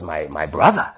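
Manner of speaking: lightly voiced German-accented old lady; old German woman. Old woman tells boys about her brother, light German accent. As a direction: imitate an elderly German woman starting an anecdote. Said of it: "this German woman with a German voice".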